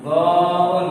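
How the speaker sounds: "दाउन" chanted with a heavy, rounded vowel; thick letter. Shown as a demonstration. Teacher showing this letter is read thick, heavy chanted "दाउन". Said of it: "ये भी मोटा पढ़ा जाएगा।".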